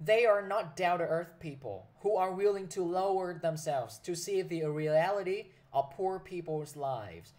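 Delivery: slow, deliberate classroom read of an English sentence; teacher slowly reading an exam sentence to students; slow reading aloud. Acted.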